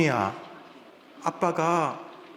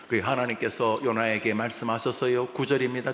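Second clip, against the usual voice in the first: normal talking voice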